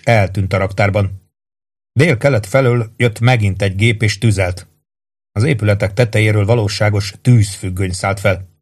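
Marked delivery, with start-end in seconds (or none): none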